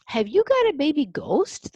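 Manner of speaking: very high, loud eager question; excited cry. A narrator's reading. Lady visitor hearing the coo, excited high cry. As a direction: burst out with delight. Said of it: "the lady cried".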